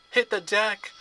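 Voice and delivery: sharp, loud, high warning shout. A narrator's reading. Annabeth warning her friends to duck, loud shout. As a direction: yell it so everyone drops flat. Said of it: "Annabeth shouted".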